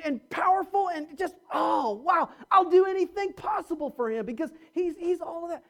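amazed. Shown as a demonstration. Preacher shows the right attitude, excited shout. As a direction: burst out in thrilled amazement at God. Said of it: "See the difference?".

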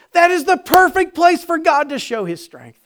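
loud, high, driving preacher voice; fervent preaching. Acted. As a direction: preach it out to the crowd with fire. Preaching at full force.

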